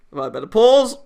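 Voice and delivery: loud high exclamation; excited outburst. Real, not acted. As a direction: burst out overwhelmed. Player reacting to scene.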